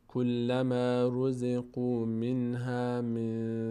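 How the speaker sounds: drawn-out melodic reading; slow recitation. Shown as a demonstration. Teacher modelling the whole phrase. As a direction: chant it syllable by syllable. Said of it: "teacher recites the phrase slowly".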